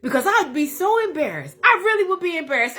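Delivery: high, sugary put-on church lady voice; bless your heart lady. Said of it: "she's a bless your heart".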